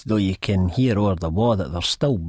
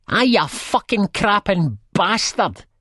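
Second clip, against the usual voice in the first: high, slow screeched insult; nasty high-pitched yell; angry shout; 13 semitones higher, 4 dB louder than usual. A narrator's reading. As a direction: scream abuse at them. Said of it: "the Raj lassie shouts at us".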